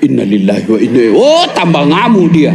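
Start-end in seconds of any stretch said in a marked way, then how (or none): 0.1-1.2 fast and low, gabbled; rushed mumbled prayer. Pretend. Husband hurrying the prayer as wife nags, fast and low. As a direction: rattle it off under your breath.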